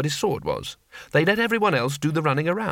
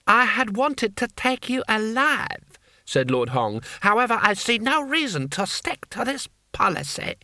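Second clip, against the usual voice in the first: high, strained villain's voice; haughty enraged lord; 8 semitones higher than usual. Acted. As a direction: pronounce the threat high and drawn out. Furious lord threatening his enemy.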